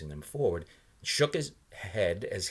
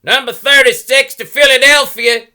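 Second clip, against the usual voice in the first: bus driver bellowing much higher and louder; bellowed; 24 dB louder than usual. A narrator's reading. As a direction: call the bus out over the engine. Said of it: "the bus driver bellowed".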